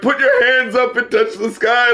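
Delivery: mock hype man call to the crowd. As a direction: pump up the crowd, tongue in cheek. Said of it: "[name]'s trying to be a fucking hype man".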